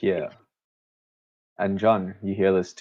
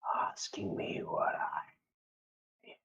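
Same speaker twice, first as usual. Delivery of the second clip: barely audible, drawn-out whisper; eerie creature voice; 12 semitones higher, 15 dB quieter than usual. Acted. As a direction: whisper very slowly with long, haunting pauses. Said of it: "the voice goes".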